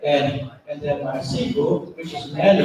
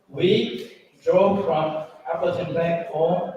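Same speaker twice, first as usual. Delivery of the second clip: ordinary delivery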